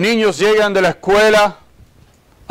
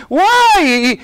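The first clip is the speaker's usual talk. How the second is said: high, drawn-out mock wail; imitated child's wail; 7 semitones higher than usual. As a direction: cry out like a wailing kid. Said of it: "un niño llorando y gritando". (grito de niño llorando, imitado)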